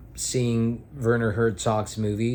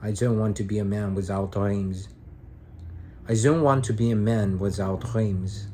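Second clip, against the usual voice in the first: a hint of Herzog's German accent, "without" said as "wizout"; light German accent; 2 semitones lower than usual. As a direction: quote him in a mild German accent. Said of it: "And his German accent".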